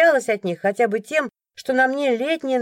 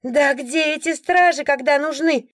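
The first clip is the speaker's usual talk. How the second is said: indignant woman; 6 semitones higher than usual. A narrator's reading. A woman in the crowd complaining about the guards, indignant. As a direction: gripe about useless guards. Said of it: "возмущённо вопрошала какая-то женщина".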